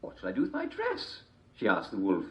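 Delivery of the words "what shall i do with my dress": put-on girl voice. A narrator's reading.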